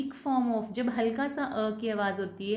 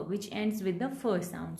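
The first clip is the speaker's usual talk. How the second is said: ordinary delivery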